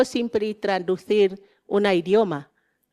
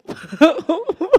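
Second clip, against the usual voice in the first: light laugh; 9 semitones higher than usual. Real, not acted. Laughs after "qué guay.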